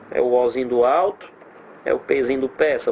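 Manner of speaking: normal talking voice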